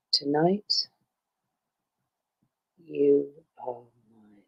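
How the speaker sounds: slow, lightly voiced seductive Minthara; romance line. Voice actress reads Minthara romance line to fan, slow and low. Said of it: "Minthara romance lines".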